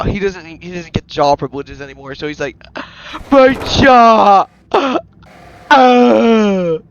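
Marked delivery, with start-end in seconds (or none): 3.3-4.5 loud, high mock wail; hammed-up yell of distress; pained cry. Character hurt, loses his jaw. 4.7-6.8 loud, high "ah" cries; hammed-up howls of pain; acted pained wail. Character wailing over lost jaw.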